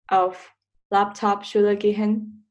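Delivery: normal talking voice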